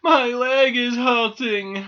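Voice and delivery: high, slow, loud whine of pain; whimpering. A narrator's reading. Squirrel to doll after the fall, whimpering. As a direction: wail it pitifully like a hurt little animal. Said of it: "whimpered Squirrel".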